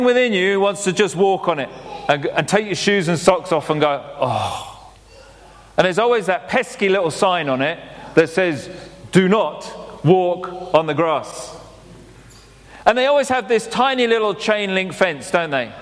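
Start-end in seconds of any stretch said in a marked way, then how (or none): 4.2-4.7 soft, low sigh of pleasure; blissful sigh. Pretend. Feet sinking into the lawn, a soft pleasured sigh. 9.1-11.5 slow, deliberate mock-official warning; stern sign reading. Reading the lawn sign aloud, slow and mock-stern.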